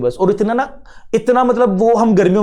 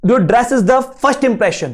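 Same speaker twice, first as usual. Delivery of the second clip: quoted saying; 3 semitones higher, much slower than usual. Retold or imitated. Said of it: "बोलते ना".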